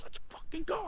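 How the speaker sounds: high strained hype yell, held down in volume; muted mock scream. Hyped teen alone driving home, muted mock scream. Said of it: "I remember screaming".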